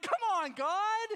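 high, loud mock plea; exasperated protest. Put-off onlooker complaining to God, exasperated. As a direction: protest upward, loudly exasperated.

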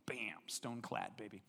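slow triumphant whisper; whispered gloat. Acted. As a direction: hush it like a secret victory. Gloating tag line.

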